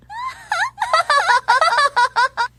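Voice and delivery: high squealing laugh; a gleeful high-pitched giggle; triumphant squeal of laughter. Acted. Unicorn laughs at decoy trick.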